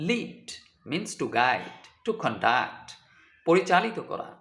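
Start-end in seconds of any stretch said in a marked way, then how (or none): 0.1-0.5 লিড with an ee vowel; লিড rhyming with need; verb pronunciation. Shown as a demonstration. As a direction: say লিড as leed. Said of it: "উচ্চারণ হবে".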